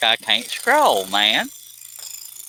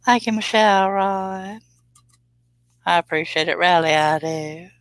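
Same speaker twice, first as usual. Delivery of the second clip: Southern Belle accent; 9 semitones higher than usual. Shown as a demonstration. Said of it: "I want to say that in a Southern Belle accent".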